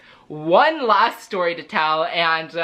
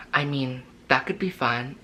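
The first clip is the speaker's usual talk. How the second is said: softer, lower hushed answer; hushed reply; 5 semitones lower, 6 dB quieter than usual. Retold or imitated. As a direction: say it low and soft, playing along. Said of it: "that's exactly how they were talking".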